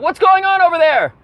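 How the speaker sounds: high-pitched imitation of someone calling out; mock yell. Passenger yells over at the commotion, mock yell. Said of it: "somebody yells out".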